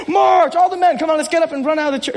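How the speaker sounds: high, loud rallying shout easing off; mock battle cry. Pastor jokingly sends men running out of church, mock battle cry. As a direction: playfully call the troops to charge.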